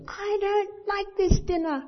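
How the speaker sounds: put-on high, slow childish whine; drawn-out, put-on kiddie complaint; complaining child. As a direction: imitate a child protesting at the table. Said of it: "And if a child says".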